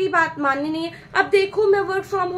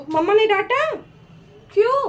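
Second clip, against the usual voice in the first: ordinary talk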